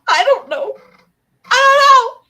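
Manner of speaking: high, loud crying bewilderment; loud, high, choked-up disbelief; tearful bewilderment. Retold or imitated. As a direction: cry out that you have no idea. Recalling bewildered crying reply.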